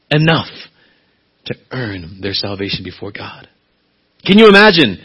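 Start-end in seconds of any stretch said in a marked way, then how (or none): 4.2-5.0 much higher, louder, rising to a cry; impassioned preaching. Acted. Preacher imagining people before God, high and loud. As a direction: build up to a pleading climax. Preaching climax about judgement.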